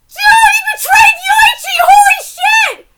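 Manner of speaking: very high, full-volume outcry; shocked shout. Real, not acted. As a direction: yell in real disbelief at a betrayal. Shocked reaction to manga betrayal.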